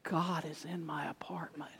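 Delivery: whispered in awe; hushed whisper. Retold or imitated. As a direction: breathe it like a secret. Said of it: "And he said".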